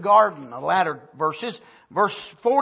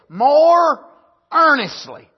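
"more" drawn out high and loud, "earnestly" following; stress on more earnestly; 8 semitones higher, 9 dB louder, slower than usual. Shown as a demonstration. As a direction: lean hard on "more earnestly". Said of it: "look at this".

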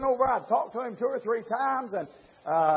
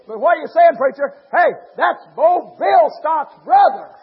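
shouted sermon, building higher and louder; fiery preaching; 7 semitones higher, 11 dB louder than usual. Acted. Preacher thundering at his congregation, full-force preaching.

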